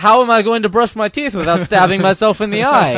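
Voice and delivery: loud, high hammed-up ad complaint; overacted helpless customer; infomercial guy. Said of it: "turn into the infomercial guy".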